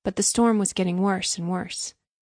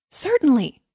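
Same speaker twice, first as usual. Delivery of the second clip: higher-pitched, eager agreement; readily exclaimed; eager cry; 6 semitones higher, 4 dB louder than usual. A narrator's reading. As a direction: cry out your willingness. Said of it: "cried little Hans".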